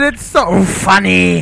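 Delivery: ordinary talk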